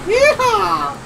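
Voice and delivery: loud, high whoop of joy; excited yeehaw. Real, not acted. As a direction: let out a big cowboy whoop. Excited cry while driving fast.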